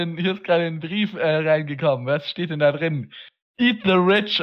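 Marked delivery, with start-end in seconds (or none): 3.6-4.4 fiery revolutionary speech. Acted. Revolutionary slogan.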